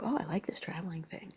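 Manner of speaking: soft whispered inner thought; whispered aside. Retold or imitated. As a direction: whisper it like a secret wish. Private thought voiced as whisper.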